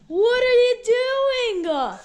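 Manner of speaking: high loud playful shout. Real, not acted. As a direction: yell it right at dad, fast. Bursting in on dad.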